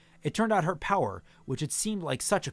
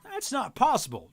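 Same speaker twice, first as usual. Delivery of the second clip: horrified; 9 semitones higher, 4 dB louder than usual. Acted. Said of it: "Finn looked horrified".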